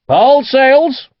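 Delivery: high, loud deck shout. A narrator's reading. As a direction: call it out over the wind. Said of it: "Cromwell shouted".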